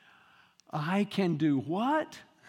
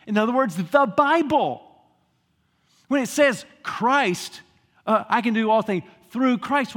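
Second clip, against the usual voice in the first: preaching at full force; 2 semitones higher, 7 dB louder than usual. Acted. Preacher urging the congregation to read the Bible, loud. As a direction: drive it home with force.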